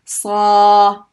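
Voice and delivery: emphatic Arabic sad. Shown as a demonstration. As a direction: punch out Sa with a heavy emphatic s. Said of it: "the letter sad".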